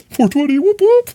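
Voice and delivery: very high squeaky "whoop"; playful falsetto whoop. Real, not acted. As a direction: cheer like a party call. Celebrating 420 time.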